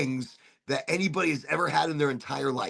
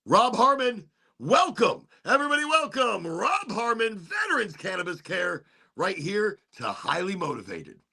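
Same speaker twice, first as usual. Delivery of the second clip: higher-pitched host announcement of a guest; show welcome; 5 semitones higher than usual. Real, not acted.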